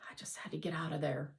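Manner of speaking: fast, breathy whisper; hushed quick confession. Acted. Raymond quietly admitting.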